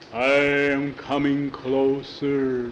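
put-on a kid faking a scary monster. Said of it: "Jackie changed his voice".

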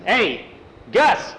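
high, loud hail across a distance; storyteller acting a summoning call. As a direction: holler for a ranch hand. Foreman calls across to the corral.

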